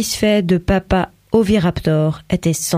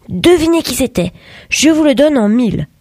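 higher, louder playful challenge; teasing excitement; 6 semitones higher, 4 dB louder than usual. A narrator's reading. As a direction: tease them to guess. Albert teases the family.

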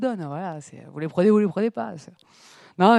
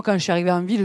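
usual speaking voice

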